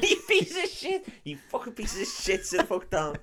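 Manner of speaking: high-pitched mock telling-off; mock angry teacher. Podcast host parodying the teacher scolding detention kids, high mock outrage. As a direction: put on a squeaky outraged teacher, amused.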